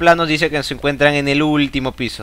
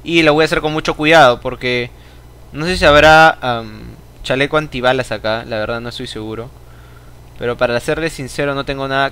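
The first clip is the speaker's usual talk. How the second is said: usual speaking voice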